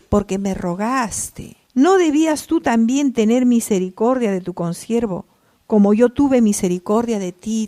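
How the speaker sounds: loud rebuke read from the pulpit; forceful preaching. Acted. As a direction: hurl the master's reproach at the servant. Preaches master's rebuke loudly. (predicación fuerte, reprochando)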